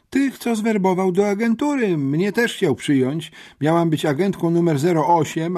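high-voiced little tattletale; usłużna uczennica. A narrator's reading. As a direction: pour out the tale in a high pupil's voice. (usłużnie, wysokim głosem uczennicy)

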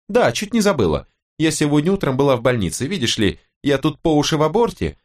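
high-pitched sarcastic wife; fed-up wife, biting and exasperated; spiteful wife. Acted. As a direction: throw the news at your husband, pitch jumping high. Narrator plays wife's imagined outburst.

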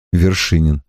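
usual speaking voice

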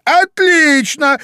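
very high, loud, drawn-out shout; bark. A narrator's reading. Queen approves the soldiers' report, barking. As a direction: bark approval at full pitch. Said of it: "гаркнула королева".

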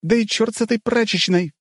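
very high, panicked female squeal; high, urgent squealed command. A narrator's reading. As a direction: shriek the warning at a very high pitch. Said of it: "взвизгнула Злата".

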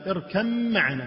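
"اركب معنا" slowly as irkamm-ma'ana, lengthened m; mim, not ba. Shown as a demonstration. Teacher repeating the Kuwait reading, mim not ba. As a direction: hold the merged م across "اركب معنا", no b. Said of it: "بالميم وليس بالباء".

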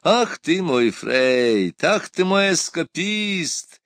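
slow, drawn-out jovial exclamation, pitched high; merry exclamation. A narrator's reading. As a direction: exclaim merrily, stretching the words. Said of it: "весело воскликнул император". (весёлое протяжное восклицание)

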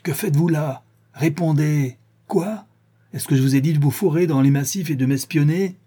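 high, agitated interrogation; angry outburst. A narrator's reading. Sholmes shakes his friend by the shoulders, furious questioning. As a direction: fire questions at him in a frenzy. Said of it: "envahi d'une colère".

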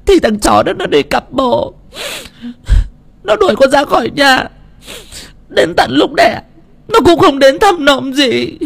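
high tearful voice sobbing out her story; crying. A narrator's reading. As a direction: weep as she pours out her troubles. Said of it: "khóc ồ ồ lên từng tiếng". (cô Thu vừa khóc vừa kể khổ với mẹ, giọng cao nghẹn ngào)

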